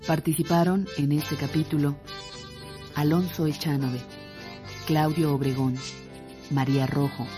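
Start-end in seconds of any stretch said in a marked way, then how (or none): none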